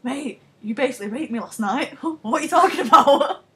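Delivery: a hint of a shocked Brad, laughing; half-laughing outraged bloke; mock Brad Pitt. Brad Pitt protesting to Tom Cruise, mock shocked. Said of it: "Brad Pitt's like".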